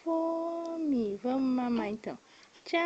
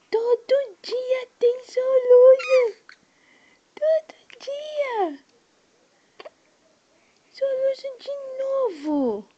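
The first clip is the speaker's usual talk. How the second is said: mock whimper; 7 semitones higher, 6 dB louder than usual. Mother to baby with hiccups, mock crying whine. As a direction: pretend to cry along with her, hammed-up.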